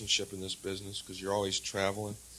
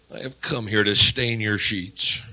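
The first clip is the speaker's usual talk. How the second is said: ordinary talk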